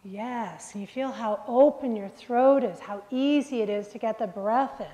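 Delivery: normal talking voice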